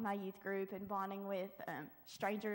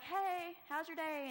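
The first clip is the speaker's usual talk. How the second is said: bright, higher hello to strangers; chirpy greeting; 8 semitones higher, slower than usual. Retold or imitated. Greeting people walking downtown, chirpy and high. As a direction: call out a cheery hey. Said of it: "and I'd be like".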